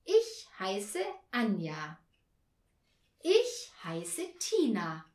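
slow, loud, separated words; slow introduction. A narrator's reading. Girls telling Herr Marconi their names, slowly. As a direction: state the name clearly for a learner.

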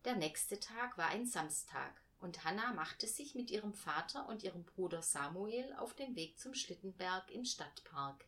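ordinary delivery, nothing marked

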